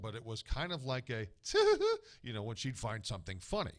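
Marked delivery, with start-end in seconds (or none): 1.3-2.0 put-on high little giggle of an old lady; a dainty high giggle, much higher and louder; grandmother's little laugh. Imitating his late grandmother's laugh, a high giggle. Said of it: "this distinctive little laugh".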